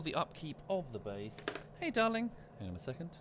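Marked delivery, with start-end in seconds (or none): 1.8-2.3 high sweet greeting to a pet. Real, not acted. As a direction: coo up high at the dog. Greeting the dog.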